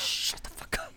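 hissing whisper, voiceless; whispered hush. Retold or imitated. Amanda silencing Crow while they eavesdrop, whispered. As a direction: shush him in a whisper so nobody hears. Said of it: "And Amanda's like".

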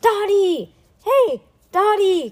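high, loud calling out; shouting. A narrator's reading. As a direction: call across a dark tunnel to a friend. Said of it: "Then they shouted".

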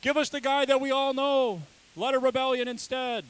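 high, loud jeering crowd, hammed up; mocking crowd voice. Preacher mocks the crowd choosing Barabbas, high loud jeer. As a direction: squeal it like a mob demanding its man. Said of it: "And they're like".

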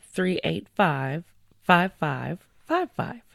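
ordinary talk, nothing marked

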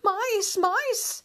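very high shriek of a maid; screamed alarm. A narrator's reading. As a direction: shriek at the sight of mice. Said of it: "she screamed".

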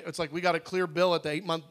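normal talking voice

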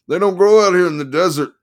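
high, loud manic lawyer; excited manic attorney. Acted. Attorney voiced.

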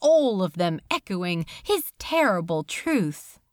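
usual speaking voice

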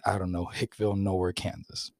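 normal talking voice

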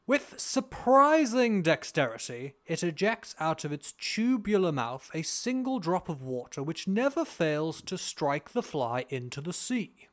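slow, grand posh British reading. Pretend. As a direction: read it like a posh British gentleman. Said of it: "I'm gonna do my posh British voice now".

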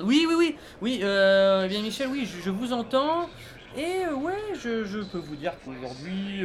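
high, loud, startled reply; flustered reporter. Acted. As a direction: caught off guard, scrambling. Reporter caught off guard, flustered. (reporter pris de court, affolé)

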